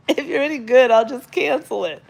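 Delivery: quoting him through a laugh, high and loud; can barely get the words out for laughing. Retold or imitated. Telling the audience about the rancher while laughing.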